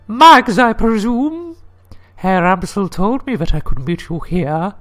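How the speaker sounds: high-pitched put-on lady's voice; high falsetto woman. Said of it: "I get to do the lady voice".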